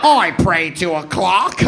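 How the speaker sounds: high, hammed-up British whine; wounded Brit griping in a mock British accent. British dude shot in the head complains, mock British accent. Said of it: "shoot the British dude in the head and he'd be like".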